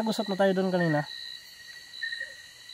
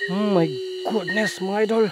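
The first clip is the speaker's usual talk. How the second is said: ordinary delivery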